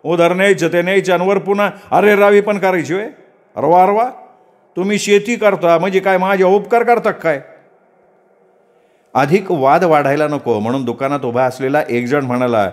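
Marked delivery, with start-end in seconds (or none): 0.0-7.4 high, indignant shopkeeper shouting him down; angry outburst. Acted. Shopkeeper snapping at Datta, high indignant anger. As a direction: bristle with outrage at the farmer. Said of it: "शेठ त्याच्यावर तावदारला".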